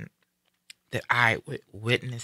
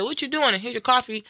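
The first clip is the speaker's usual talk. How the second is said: bright cheerful greeting; 7 semitones higher than usual. Retold or imitated. Bringing dad coffee, cheerful.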